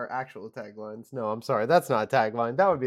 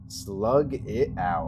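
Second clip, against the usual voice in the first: slow tagline read; 3 semitones lower, much slower than usual. Pretend. Reads tagline.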